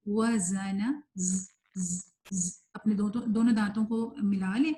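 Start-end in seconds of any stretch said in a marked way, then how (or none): 0.1-2.6 long whistling buzz on ز; demonstrated articulation. Shown as a demonstration. Teacher reading examples to students, sustained buzzing ز. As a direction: say وزن slowly, then isolate ز as a sustained buzz. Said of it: "اس کی examples پڑھتے ہیں".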